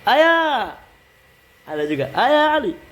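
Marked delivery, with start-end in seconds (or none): 0.1-0.8 high calling "Ayah". Shown as a demonstration. 2.1-2.8 calling out. Shown as a demonstration.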